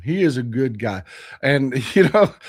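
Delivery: usual speaking voice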